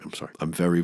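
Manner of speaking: ordinary talk, nothing marked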